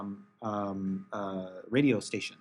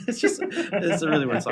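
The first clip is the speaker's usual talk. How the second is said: laughing; 5 semitones higher, 4 dB louder than usual. Real, not acted. Host cracking up over the weird song, laughing. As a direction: talk through laughter, higher and louder.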